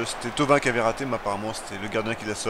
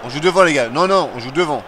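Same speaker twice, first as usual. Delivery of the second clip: urgent shouting; 4 semitones higher, 8 dB louder than usual. Real, not acted. Urging team forward.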